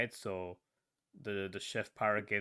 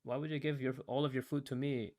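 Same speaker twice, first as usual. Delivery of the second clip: higher-pitched, pleading questions in mock dismay; retold disbelief; 5 semitones higher, 4 dB louder than usual. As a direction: act out not understanding the sacrifice.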